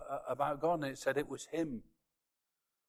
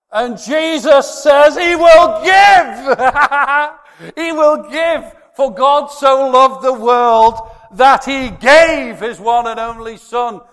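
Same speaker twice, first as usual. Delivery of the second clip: shouted, much higher and louder, slow; preaching at full force; 11 semitones higher, 20 dB louder, slower than usual. Real, not acted. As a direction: preach it out with everything you have.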